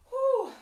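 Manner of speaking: high, loud puff of breath; relieved whew. Real, not acted. Instructor catching breath in the hamstring stretch, high relieved whew. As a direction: exhale a high "whew" after effort.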